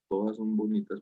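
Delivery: normal talking voice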